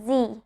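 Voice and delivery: letter "Z" said as zee; "Z" the American way, zee. Shown as a demonstration. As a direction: say "Z" to rhyme with see. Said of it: "because in American accent".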